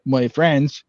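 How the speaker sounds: ordinary talk, nothing marked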